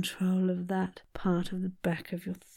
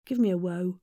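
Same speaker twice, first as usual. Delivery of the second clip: normal talking voice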